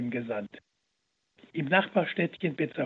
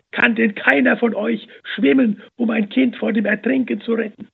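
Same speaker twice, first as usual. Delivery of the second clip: desperate plea; 6 semitones higher, 7 dB louder than usual. A narrator's reading. Mother begs men to act.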